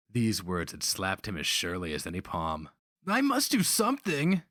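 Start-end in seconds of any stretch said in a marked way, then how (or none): 3.1-4.4 put-on high, indignant young voice; boy's bitter retort. A narrator's reading. Young Holka boy retorting bitterly to the slaver. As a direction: throw the word back at him.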